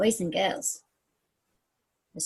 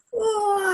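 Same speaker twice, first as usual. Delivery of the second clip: put-on higher, louder playful roar with the children; group roar; 8 semitones higher, 4 dB louder than usual. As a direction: lead the kids in a big pretend roar. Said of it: "You do a roar".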